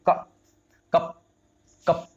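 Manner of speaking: कप with a quick, brief अ; isolated कप, said short as a model word; very short vowel. Shown as a demonstration. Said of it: "बहुत ही छोटी sound है".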